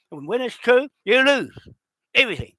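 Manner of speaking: angry shout. Real, not acted. Warning opponents they will lose their land, shouting.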